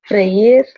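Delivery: freír said with a rolled r and stress on the í; clear Spanish freír. Shown as a demonstration. Tutor models the Spanish word freír for a student. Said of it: "Next one".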